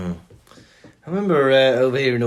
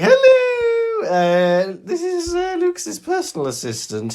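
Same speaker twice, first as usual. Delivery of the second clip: prim secretary voice, much higher; fake secretary; 3 dB louder, slower than usual. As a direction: pose as your own assistant on the answer message. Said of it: "pretending to be my own secretary".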